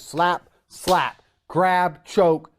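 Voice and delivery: usual speaking voice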